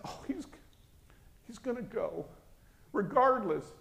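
high, fretful mother voice; worried objection. Retold or imitated. Mother arguing with the voice about her child, high and worried. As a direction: object helplessly, pitch lifted well up.

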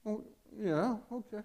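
a high, unconvinced little "oh yeah, okay"; half-hearted yes. Retold or imitated. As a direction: imitate mom agreeing without real belief. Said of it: "that's kind of a half-hearted thing".

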